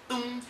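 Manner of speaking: soft, level "tum" with a hummed ring; imitated string note. Shown as a demonstration. Teacher sounding the fretted string, imitated string note. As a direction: mimic a guitar string being plucked.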